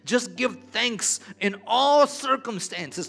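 building to a loud, high preaching cry; fiery sermon, slow and stretched on the key words. Acted. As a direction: preach it, climbing to full force. Preaching builds to full force.

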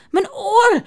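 annoyed ouch about an ear; cross complaint. A narrator's reading. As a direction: snap at the one who screamed in your ear. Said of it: "zegt de stem boos".

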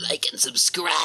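hushed whispered sign-off. Pretend. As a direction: breathe it out in a whisper. Outro sign-off.

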